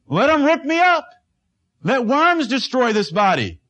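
high, loud defiant cry. Acted. Job in the sermon crying out his defiance, high and loud. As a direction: fling it out, let them do their worst. Preacher voices Job's defiant resolve.

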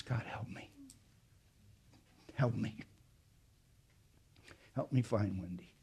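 barely voiced plea to God; desperate whispered prayer. Father pleading with God for his lost daughter, whispered. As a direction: whisper it, nearly breaking. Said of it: "I could hardly talk".

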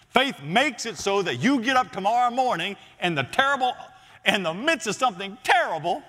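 high, driving sermon delivery; forceful preaching. Real, not acted. Preacher tells congregation faith is a foundation underneath them, forceful preaching. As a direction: press the promise on the congregation with force.

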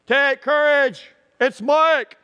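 loud, high holler of identification; acted long-distance yell; calling out. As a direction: shout it to a boat far away. Acting his own call across water.

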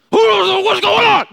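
loud high drawn-out bellow; a woken stranger shouting into the dark; gruff shout. Retold or imitated. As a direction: bellow it slowly at the intruder. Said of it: "this gruff tone".

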